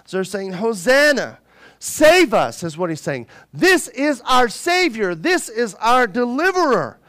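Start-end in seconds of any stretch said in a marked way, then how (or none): 0.5-2.6 higher, louder acclaiming cry; imitated crowd shout. Preacher voicing followers hailing their king, imitated shout. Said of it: "all of his followers began to shout". 3.5-7.0 higher, emphatic proclamation; imitated crowd acclaim. Preacher voicing followers hailing their deliverer, imitated acclaim. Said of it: "all of his followers began to shout".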